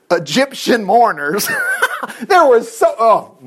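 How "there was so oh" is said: high burst of laughter; laughing. Real, not acted. Laughs at the name.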